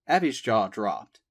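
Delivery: ordinary delivery, nothing marked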